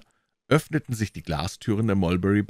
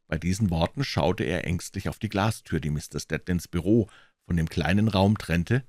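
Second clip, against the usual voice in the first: ordinary delivery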